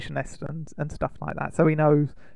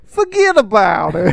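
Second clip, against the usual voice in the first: slow, louder New York "forget about it"; New York accent; 3 semitones higher, 7 dB louder than usual. Pretend. Host tries New York again for co-host, slow and loud. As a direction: lean into the New York mobster line. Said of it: "that was a bit more New Yorky".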